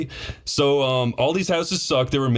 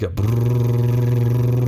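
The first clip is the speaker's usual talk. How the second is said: imitated rumble; 3 dB louder than usual. Imitating tub vibration.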